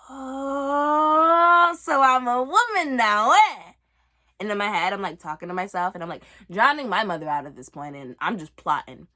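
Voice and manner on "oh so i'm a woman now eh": smug. Retold or imitated. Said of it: "But I was also like".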